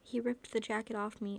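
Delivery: usual speaking voice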